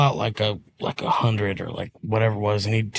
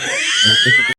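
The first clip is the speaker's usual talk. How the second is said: squealing laugh; real high laugh. Real, not acted. Laughing at story.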